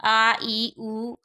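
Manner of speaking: आ, ई, उ each held long and drawn out; long vowels. Shown as a demonstration. Teacher to class, demonstrating long आ ई उ slowly. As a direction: stretch every vowel to double length. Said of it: "इसमें ज़्यादा समय लगता है".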